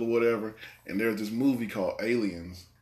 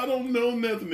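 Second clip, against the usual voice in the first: mock panicked crook; 13 semitones higher than usual. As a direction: play the dangling crook, pitched way up. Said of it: "He's like".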